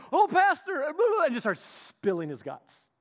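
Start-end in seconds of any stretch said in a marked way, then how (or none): 0.1-1.3 loud, high-pitched mock outburst; distraught caller outburst. Troubled caller to pastor on the phone, blurting out. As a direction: burst in, imitating a caller about to spill everything. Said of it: "the guy that called is just like".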